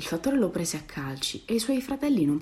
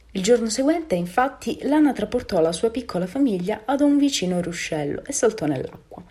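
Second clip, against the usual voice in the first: ordinary delivery, nothing marked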